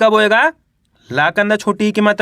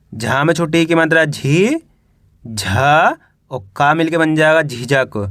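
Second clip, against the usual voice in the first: spelling drill recitation; 4 semitones lower than usual. Shown as a demonstration. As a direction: recite it like a classroom spelling drill, lower than chat. (हिज्जे करके, खींच-खींचकर पढ़ाने का लहजा)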